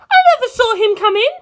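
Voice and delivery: put-on a high, fierce girl set on revenge; Penelope's voice. Penelope still frightened.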